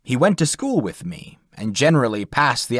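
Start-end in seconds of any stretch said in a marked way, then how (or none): none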